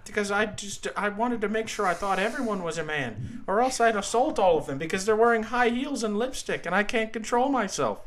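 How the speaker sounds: earnest, aggrieved professor impression; Jordan Peterson. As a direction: rant like an indignant lecturing academic. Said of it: "I got the Peterson".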